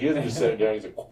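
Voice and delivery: ordinary delivery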